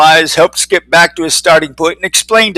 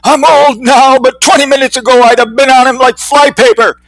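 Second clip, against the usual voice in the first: put-on old-timer voice; 8 semitones higher, 4 dB louder than usual.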